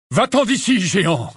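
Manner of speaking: high, forceful order; Magorian exclaiming. Acted. Centaur ordering the giant to leave, exclaiming. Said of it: "s'exclama Magorian".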